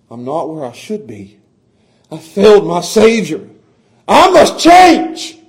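rising to a loud, high plea at the end; mild broken confession. Acted. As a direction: voice a repentant sinner's cry. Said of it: "you hope that there's gonna be some brokenness".

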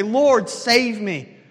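very slow, loud plea; pleading prayer. Acted. Preacher modelling the congregation's prayer: very slow, loud plea. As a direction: call out for rescue, drawing each word out. Urging the listeners to pray this.

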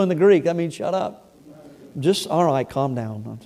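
toned-down translation, no force; quiet aside. Acted. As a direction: drop it low in volume as an explanation. Explains the Greek in an aside.

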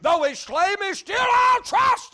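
loud, high, hollered sermon climax; full-force preaching. Real, not acted. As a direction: raise the roof on the closing line.

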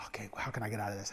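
fast, whispered inner scramble; quick hushed panic; panicked whisper. Retold or imitated. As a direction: whisper your escape plan fast.

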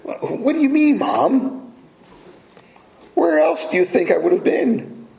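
put-on high, incredulous young voice; incredulous son. Jesus answering his mother, high and incredulous. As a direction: play a boy amazed his mom didn't know. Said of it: "Jesus Christ is saying".

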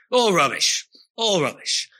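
mock an angry passer-by scoffing; angry dismissal. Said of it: "often say it very angrily".

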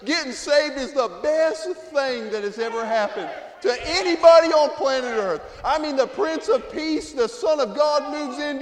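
loud, high preaching cadence; full-force preaching. Acted. Preacher exhorting the congregation to get saved, loud. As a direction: preach it, lifting and pounding.

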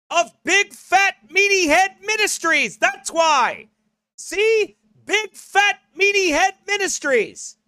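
high-pitched, grandiose self-announcement; mock proclamation. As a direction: proclaim it high and proud, tongue in cheek. Mock proclamation answering his critics.

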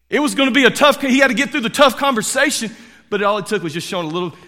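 high, loud building preacher's push; preacher rising in pitch and volume; emphatic preaching. Real, not acted. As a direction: drive the point up with force.

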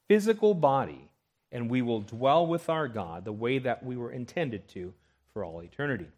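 normal talking voice

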